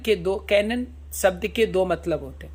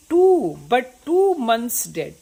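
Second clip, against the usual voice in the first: slow, drawn-out reading of Shakespeare; slow recitation; 4 semitones higher, 4 dB louder, much slower than usual. Shown as a demonstration. Teacher reading Hamlet's soliloquy to students, slow solemn recitation. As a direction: recite each word slowly and solemnly.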